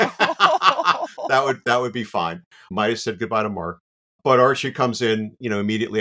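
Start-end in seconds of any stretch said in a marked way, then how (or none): 0.0-1.6 laughing. Real, not acted. Laughing at being snubbed.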